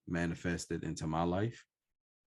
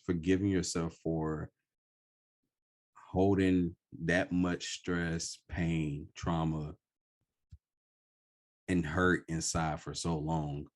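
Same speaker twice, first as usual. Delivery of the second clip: normal talking voice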